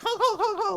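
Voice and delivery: high-pitched imitated goose honks; angry goose honking. Goose answers back the cousin, mock angry honking. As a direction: honk repeatedly like an irate goose. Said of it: "like, angry honking".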